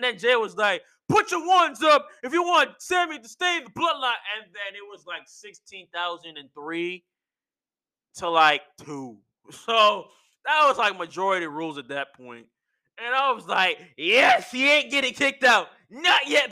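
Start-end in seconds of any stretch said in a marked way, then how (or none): 1.1-4.1 high-pitched, energetic call to a crowd; retold crowd rallying. Imitating Jey rallying the crowd for Sami, called out. 14.0-16.5 loud, raised-pitch celebration; retold triumphant cheer. Fan cheering that Sami stays, exultant shout. Retells own cheer at the vote.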